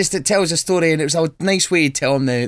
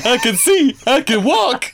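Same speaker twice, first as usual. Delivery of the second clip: high miracle voice; 11 semitones higher, 4 dB louder, slower than usual. Retold or imitated. Imitating fake healed beggar.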